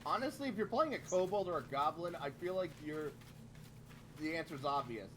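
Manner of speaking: ordinary talk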